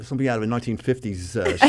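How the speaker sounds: ordinary talk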